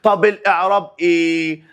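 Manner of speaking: ordinary delivery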